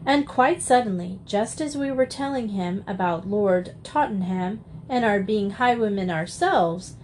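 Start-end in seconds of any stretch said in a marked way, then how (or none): none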